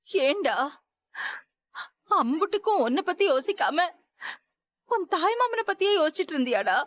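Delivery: hurt and scolding through tears; tearful scolding. Acted.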